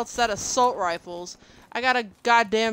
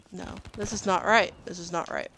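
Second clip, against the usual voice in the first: normal talking voice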